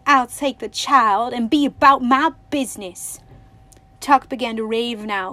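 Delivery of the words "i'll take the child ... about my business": angry. A narrator's reading. Man drags Winnie away.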